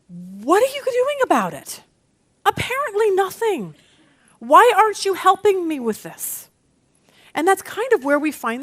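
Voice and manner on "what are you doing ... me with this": higher-pitched, exasperated demand; acted indignation. Believer challenging God for help, acted indignation. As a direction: complain upward in mock outrage.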